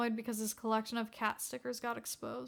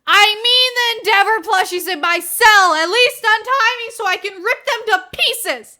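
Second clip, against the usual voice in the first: loud, high frenzied shouting; villain screaming at his guard; furious rant; 9 semitones higher, 17 dB louder than usual. Acted. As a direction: rage to tear them apart. Villain rages at plushies.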